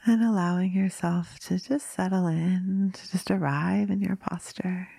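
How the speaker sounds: drifting into whispers between long pauses; guided meditation. Acted. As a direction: ease listeners gently into stillness.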